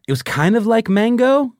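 high, slow diva voice; hint of a performance-y diva; mock diva. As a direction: sing it out like a diva. Evoking a diva character.

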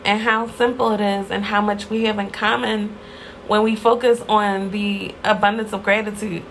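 ordinary talk, nothing marked

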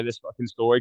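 ordinary talk, nothing marked

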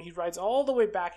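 normal talking voice

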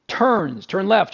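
ordinary delivery

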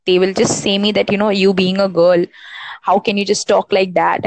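normal talking voice

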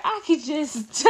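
slow, hammed-up dramatic sigh; dramatic mom quote. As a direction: play the drama queen mom. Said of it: "she was like".

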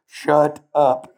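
loud laughing snap; mock stern Father; mock Father's rebuke. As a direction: cut him off with a laugh. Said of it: "the Father goes".

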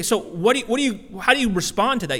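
normal talking voice

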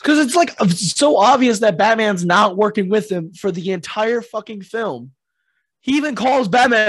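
fired-up complaint about the plot; excited rant. Real, not acted. Ranting about the film.

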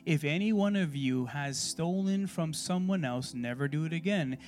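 loud, slow, emphatic verse reading; scripture reading. Real, not acted. Preacher reads verse to young congregation, emphatic scripture reading. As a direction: proclaim the verse slowly and loudly.

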